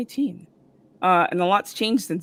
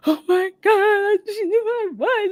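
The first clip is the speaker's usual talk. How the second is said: mock bawling; 13 semitones higher, 4 dB louder than usual. Fan phoning her husband in shock, mock bawling. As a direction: act out tearful overjoyed wailing. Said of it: "screamed, and just started bawling".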